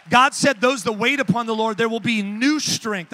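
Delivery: fiery preaching. Acted. Preacher urging lazy Christians in the congregation, fiery shout. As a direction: preach it out to the whole room.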